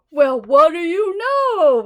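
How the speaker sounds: slow, loud amused exclaim; laughing. Acted. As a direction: marvel with a laugh. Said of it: "laughed the elephant".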